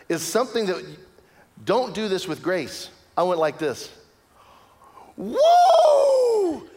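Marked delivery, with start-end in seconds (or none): 5.2-6.7 loud high whoop of victory; a real yell of triumph; triumphant whoop. Retold or imitated. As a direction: whoop like you just scored. Celebrating goal on the field.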